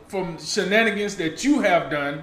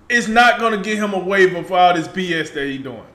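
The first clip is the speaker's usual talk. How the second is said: normal talking voice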